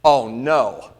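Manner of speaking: low, loud pilot's dismay; lightly acted pilot on the intercom; alarmed pilot. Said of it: "you hear the pilot say".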